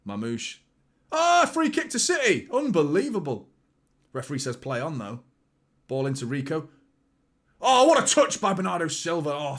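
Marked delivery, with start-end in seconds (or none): 1.1-3.5 high, loud indignant cry; loud, high outburst; outraged shout. Real, not acted. Reacting to foul on his team. 7.6-9.3 loud, high delighted exclaim; loud delighted cheer; excited praise. Real, not acted. Admiring a skilful touch.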